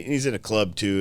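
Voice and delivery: normal talking voice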